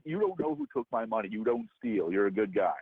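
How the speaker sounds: sarcastic. Real, not acted. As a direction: throw his own words back at him, flat and scornful.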